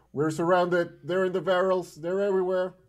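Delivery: high, loud alarm call; panicked yell. Acted. Archie warning teammates of an ambush, yelling high and loud. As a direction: yell a warning to the whole party.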